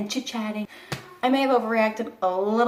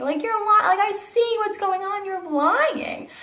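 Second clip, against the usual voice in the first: much higher, heated protest; incredulous accusation; 11 semitones higher than usual. Retold or imitated. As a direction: catch them in a lie, pitched up. Accuses staff of lying.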